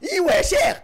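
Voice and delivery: loud celebratory cry; joyful shout. Real, not acted. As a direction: cheer the goal out loud. Celebrating a goal.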